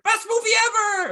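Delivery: high, excited mock fan cheer; mock hype gamer. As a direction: squeal it like an overhyped fan. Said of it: "they're like comic book people".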